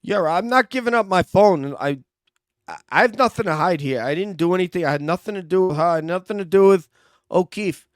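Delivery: a touch of a defensive suspect, pitch up; defiant suspect. Acted. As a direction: refuse firmly but keep your cool. Suspect refuses to give phone.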